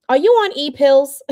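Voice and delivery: a medic who thinks you're high; incredulous paramedic. Retold or imitated.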